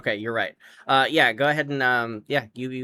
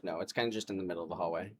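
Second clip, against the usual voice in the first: ordinary talk, nothing marked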